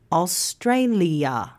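Australia said slowly, all four syllables apart; slow syllable count. Shown as a demonstration. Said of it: "Australia has four syllables".

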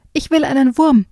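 high, stressing will, insisting; stubborn whiny child. Acted. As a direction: hammer the will like a spoiled kid.

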